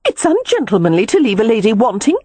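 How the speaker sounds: high, heated woman's protest. A narrator's reading. Frustrated woman scolds the lover leaving her, heated. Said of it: "she said heatedly".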